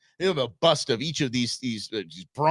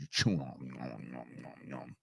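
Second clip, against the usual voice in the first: put-on low, mumbled "nom, nom, nom" like a toothless old man; mock chewing noises; 12 semitones lower, 15 dB quieter than usual. Mocking old coach chewing.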